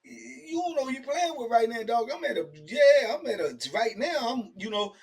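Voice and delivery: high exasperated inner thought. Retold or imitated. Thinking about the crazy cellmate, high exasperation. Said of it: "I started thinking in my mind".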